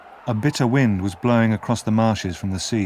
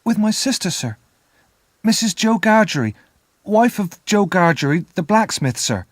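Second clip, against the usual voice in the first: put-on high child's voice, louder, eager to please; frightened boy; 9 semitones higher, 3 dB louder than usual. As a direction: answer the stranger fast and politely. Boy answers convict.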